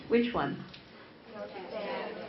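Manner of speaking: normal talking voice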